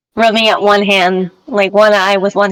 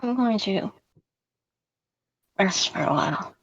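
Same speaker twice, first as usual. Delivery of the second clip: very soft, trailing away; fading quiet; 12 dB quieter than usual. Acted. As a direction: let the voice fade to nothing. Character fading, falling limp.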